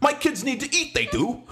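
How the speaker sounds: high-pitched caricature Cockney voice; mock Cockney. As a British parent outside Target, mock Cockney. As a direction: put on a high, hammed-up Cockney. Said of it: "those are like the British people".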